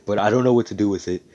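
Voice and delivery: ordinary delivery, nothing marked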